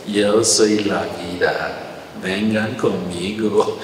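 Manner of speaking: low, soft and slow; almost too soft to hear, gently inviting; barely audible Jesus. Retold or imitated. Jesus softly calling, so quiet it's barely heard. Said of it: "casi, casi no se oye".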